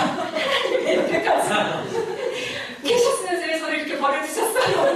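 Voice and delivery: high, loud laughing complaint to God; laughing reproach. Retold or imitated. Believer half-laughing, reproaching God for abandoning her. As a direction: laugh through a hurt question. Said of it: "이런 것과 함께".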